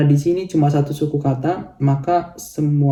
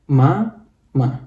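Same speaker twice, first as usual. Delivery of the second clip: syllables separated. Shown as a demonstration. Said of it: "Ini kan ada dua suku kata ya".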